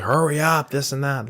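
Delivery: impatient yell. Retold or imitated. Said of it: "screaming 'cause".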